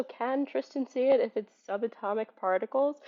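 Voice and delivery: ordinary talk, nothing marked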